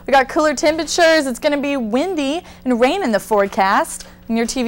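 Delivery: weather report read. Acted. Weather anchor opening read.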